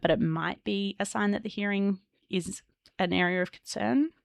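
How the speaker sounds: normal talking voice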